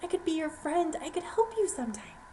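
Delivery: high pleading, fading to a whisper; panicked pleading. Acted. Mouse begs the lion not to eat her, panicked. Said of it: "I was panicking".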